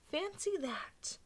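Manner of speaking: put-on high, slow amazed old lady.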